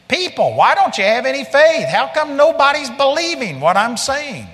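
high-pitched acted scolding; exasperated rebuke. Preacher as Jesus rebuking faithless disciples, exasperated.

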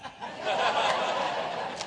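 soft laugh without words; amused laugh. Real, not acted. Preacher after retelling his Bible retort, amused laugh. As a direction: laugh at your own comeback.

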